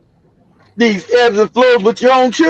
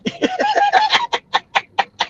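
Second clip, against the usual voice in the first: breathless high-pitched wheeze of laughter; cracking up silently, breath squeaking; high wheezing laugh; 5 dB quieter than usual. Real, not acted. Comedian wheezing at his own story, high laugh.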